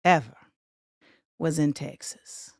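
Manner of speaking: upbeat host calling out cities over music; hype livestream host. Acted. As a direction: shout out the viewers like a party host. Hosts livestream roll call over music.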